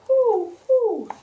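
high, loud hooting WHO; owl's hoot. A narrator's reading. Storyteller voices an owl for children, high hoots. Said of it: "asked the owl".

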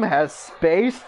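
ordinary talk, nothing marked

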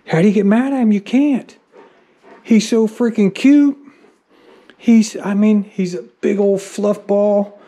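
loud, high-pitched helpless adoration; doting exasperation. Real, not acted. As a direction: gush helplessly over an adorable pet. Doting on the dog's face.

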